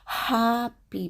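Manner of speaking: Happy with a thin breathed h, not exaggerated; breathy h. Shown as a demonstration. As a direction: breathe the h lightly. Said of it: "like you're breathing".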